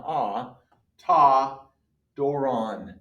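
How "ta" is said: "Ta" said loud with an open ah; Greek article ta, stressed. Shown as a demonstration. Teacher repeating "Ta" loudly for the class. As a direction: hit "Ta" clearly as tah. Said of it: "It's an omicron".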